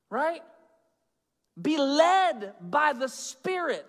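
high, forceful preacher delivery; emphatic preaching. Acted.

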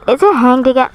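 loud annoyed yell; angry shouting. Real, not acted. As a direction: shout at the frozen game. Game freezes mid-chase.

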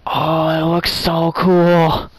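slow, high and awed. Real, not acted. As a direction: marvel at it. Amazed at distant view.

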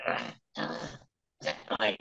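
soft breathy creature squeaks; small creature sneaking for the cheese; creature sounds. Retold or imitated. Little creature panting softly while climbing for cheese. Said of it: "So I go".

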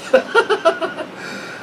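high unvoiced giggle; a short breathy cackle; high breathy laugh. Real, not acted. Vlogger laughing at pissing people off, breathy giggle.

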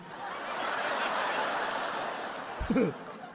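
breathy stifled giggle into the phone; stifled laugh. Retold or imitated. Husband on phone stifling a laugh at wife. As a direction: hold back a laugh at her.

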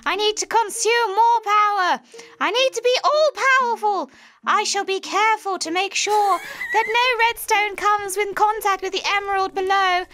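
high, theatrical mad witch reading her log. Pretend. Reading the villain's log to friends in a witch voice. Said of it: "do your witch voice".

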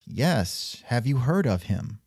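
ordinary talk, nothing marked

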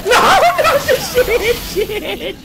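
very high laughing shriek; shrieking panic. Real, not acted. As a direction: shriek as it all goes wrong. Hit by boss, laughing panic.

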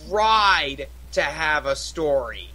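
TRIED drawn out slowly and leaned on; heavy, slow emphasis on "tried"; stress on TRIED. Real, not acted. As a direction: stretch out "tried" as the doubtful word. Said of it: "Emphasis on tried".

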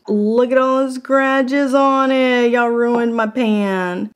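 put-on slow, drawn-out country accent. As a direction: stretch it out in a hillbilly country accent. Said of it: "Why do I have a country accent today?".

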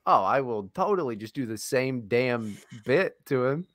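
usual speaking voice

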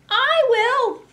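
high, loud piggy squeal. Acted. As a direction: squeal it like a little pig. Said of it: "squealed the pig".

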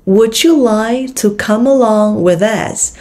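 whole question slow with "would you" linked; slow linked model. Shown as a demonstration. Teacher models the linked question slowly. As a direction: read it slowly, linking the words. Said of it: "nối âm".

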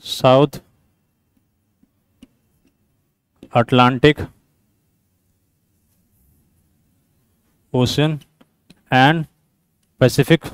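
low, soft, word-by-word dictation; slow dictation. Real, not acted. Teacher reading out a quiz statement for students, slow dictation. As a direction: dictate slowly with long gaps between words.

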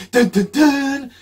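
loud rising dun dun dun jingle; dramatic news sting. Retold or imitated. Mocking the TV news with a loud sting. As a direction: belt out a mock news sting. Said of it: "The news goes".

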